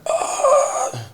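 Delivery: high yelp of pain, imitated; recreating a sharp cry of pain; retold pained cry. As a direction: yelp as the shoulder crunches. Crunch of injured shoulder.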